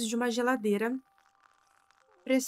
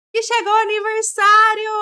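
much higher, loud excited cry; excited shout; 10 semitones higher, 12 dB louder than usual. Real, not acted. Streamer cheering to viewers as birthday arrives, excited shout. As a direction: burst out with it's-here joy.

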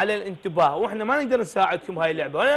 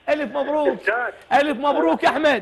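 booming prize announcer; 6 semitones higher than usual. Acted. Host announces prize win.

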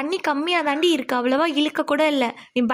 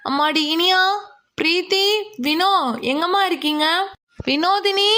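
calling out; 3 semitones higher than usual. Acted. Aunt searching for the girls by the river, calling out. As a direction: call their names anxiously across a distance.

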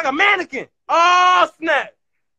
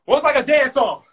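ordinary delivery

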